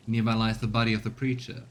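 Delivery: ordinary talk, nothing marked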